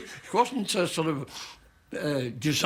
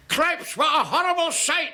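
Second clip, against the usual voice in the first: high, loud mock heckle; slow, exclaimed Scots jeer; Scottish heckler; 4 dB louder, slower than usual. Drunk Scotsman heckling from the third row, appalled. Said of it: "a Scotsman uncoiled himself".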